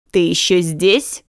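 slow, cold haughty voice; a stern noblewoman, unimpressed; cold dismissive lady. A narrator's reading. Duchess dismisses girl coldly.

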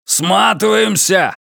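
loud, slow barked shout; barking shout. Acted. Hermund ordering a retreat, barked shout. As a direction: bark the order to run. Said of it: "рявкнул Гермонт".

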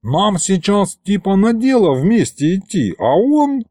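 high-pitched aggrieved complaint; protest, rising to a drawn high finish; indignant. A narrator's reading. Demon argues they should work together, indignant. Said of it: "возмутился Аристофан".

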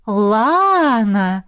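long drawn-out «Лана», pitch arching up; «Лана» stretched in a knowing, astonished echo; surprised echo question. A narrator's reading. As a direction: echo «Лана» back, stretching the first vowel with teasing interest. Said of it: "в один голос переспросили мы с сестрой".

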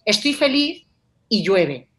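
"Estoy feliz" lifted, pause, then "y llueve" equally weighted; two balanced halves joined by "y"; both halves equal. Shown as a demonstration. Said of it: "los estás poniendo al mismo nivel".

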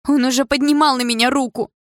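high, loud accusation; desperate insistence. A narrator's reading. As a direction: cry it out to be believed. (отчаянный громкий выкрик)

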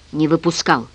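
plain reading voice

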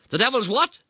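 a dad who can't believe his ears; puzzled dad. Retold or imitated. Puzzled dad questioning his wife, loud and baffled. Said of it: "my dad said, looking puzzled".

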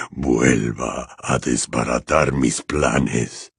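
very slow, low dark-lord voice; cold menacing villain. Acted. Voldemort lamenting his ruined plans, cold menace. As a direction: weigh every word with cold displeasure, deep.

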